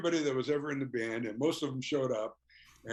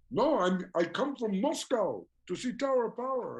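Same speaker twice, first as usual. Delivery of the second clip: imitating the Russian fan; 10 semitones higher than usual. Fan telling the singer why he came, higher imitated voice. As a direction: play the earnest fan who flew in for the band.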